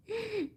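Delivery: sudden high-pitched soft giggle; high squealing laugh. Real, not acted. Streamer giggling at a viewer calling her pilloo. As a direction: let out a squeaky burst of laughter.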